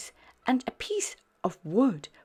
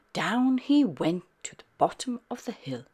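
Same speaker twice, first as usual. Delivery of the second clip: usual speaking voice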